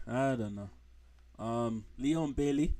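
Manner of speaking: ordinary talk, nothing marked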